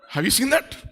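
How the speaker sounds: normal talking voice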